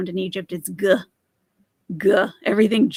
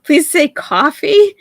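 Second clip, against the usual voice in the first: loud, high, slow pleading; 7 semitones higher, 10 dB louder, slower than usual. Real, not acted. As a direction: beg her to say the word, louder. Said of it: "Did you think I was gonna say coffee?".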